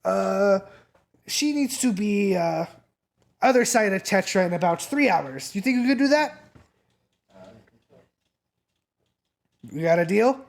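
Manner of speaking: high, eager sales pitch; pushy dealer. Acted. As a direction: press them to close the deal. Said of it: "he'll be like".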